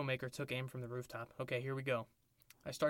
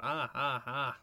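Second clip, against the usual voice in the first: hammed-up rhythmic "ah"s, a bit louder; mock gasps; 4 dB louder, slower than usual. Soldier against the wall, hammed-up rhythmic moans. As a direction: ham up breathless moans for the listeners. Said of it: "I gasped".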